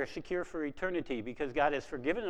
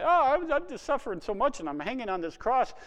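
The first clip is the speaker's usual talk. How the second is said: mock whiny self-pitying complaint, pitched higher and louder; 9 semitones higher, 5 dB louder than usual. Preacher mocking a self-pitying Jesus, higher and louder. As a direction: play a sufferer feeling sorry for himself. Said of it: "He's not saying".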